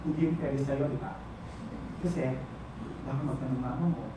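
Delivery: very low, soft reproach; bitter, subdued. Acted. Partner citing the lover's family fears, low and bitter. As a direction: sink low and quiet with hurt.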